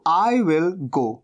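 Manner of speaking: very slow, each word spaced; teacher dictating the English line slowly; slow dictation. Shown as a demonstration. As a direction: read it out slowly for students to write. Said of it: "teacher dictates the translation".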